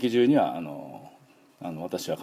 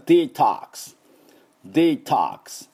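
American "デトックス" as dee-TAHKS, open O; higher, deliberate American demo of detox; American English accent; 5 semitones higher, 4 dB louder, slower than usual. Shown as a demonstration. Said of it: "アメリカ人は".

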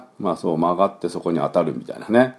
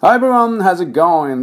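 bright greeting; 10 semitones higher, 4 dB louder, slower than usual. Real, not acted. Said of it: "cheerful show opener".

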